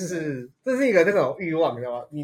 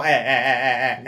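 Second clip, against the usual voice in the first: laughing imitation of the friend; 3 semitones lower, 4 dB louder, slower than usual. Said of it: "有赏就说".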